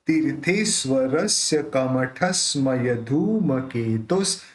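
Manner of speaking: careful syllabic recital; slow pronunciation demo. Shown as a demonstration. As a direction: say तीर्थेश्वरस्य with the र joined to ती, slowly. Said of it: "उसको ती के साथ जोड़ के बोलेंगे".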